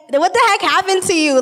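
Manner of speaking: shocked disbelief. Retold or imitated. Joseph shocked at pregnant Mary.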